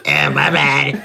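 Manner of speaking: odd put-on voice. Shown as a demonstration. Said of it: "talking like this".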